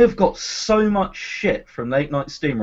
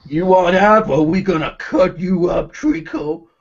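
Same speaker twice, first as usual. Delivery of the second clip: mock American accent. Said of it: "Is that your American accent?".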